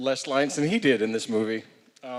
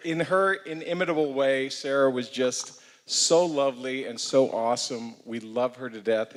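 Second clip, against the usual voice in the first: ordinary delivery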